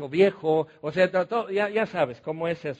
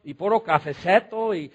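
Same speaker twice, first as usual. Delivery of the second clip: mocking his own Sierra Negra accent, louder; 5 dB louder than usual. Laughing at himself for still talking like the Sierra folk, in a Sierra Negra accent. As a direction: replay the Sierra Negra way of saying "cafeceto". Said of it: "Otra vez estoy hablando así".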